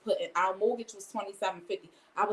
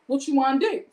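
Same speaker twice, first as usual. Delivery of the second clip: louder and higher, squaring up; confrontational; 6 semitones higher, 6 dB louder than usual. Retold or imitated. Wife squares up to husband mid-argument, loud challenge. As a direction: challenge him to a fight.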